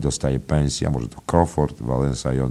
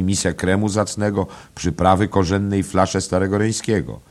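higher-pitched servile host voice; obliging innkeeper, tempting; 5 semitones higher than usual. Acted. Innkeeper offering a feast to royal envoys, obliging and tempting. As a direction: reel off the dishes to please the guests.

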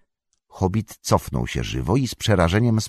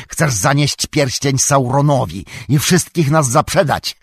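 raised angry tirade, building to a shout; wściekły wrzask; 7 semitones higher, 6 dB louder than usual. A narrator's reading. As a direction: rage louder and higher towards a curse. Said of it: "wrzasnął". (narastający, wściekły krzyk)

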